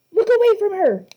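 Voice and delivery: loud, high urgent shout. A narrator's reading. Annabeth warns the boys, shouting loud and high. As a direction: yell the warning. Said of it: "Annabeth shouted".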